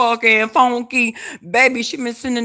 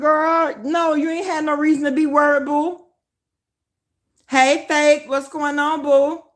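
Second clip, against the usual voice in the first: ordinary talk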